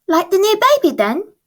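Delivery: put-on high, eager small girl asking her mum; little girl voice. Francie asks Mum about her sister, high child voice. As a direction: lift into a bright child's voice.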